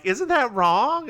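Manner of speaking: high, slow questioning voice; Jess. Retold or imitated. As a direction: raise the pitch, draw out the doubt. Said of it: "And Jess is like".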